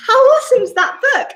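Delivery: high, loud, fast gush about the book; excited. Real, not acted. Reader to kids after finishing the book, excited gush. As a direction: burst out with delight.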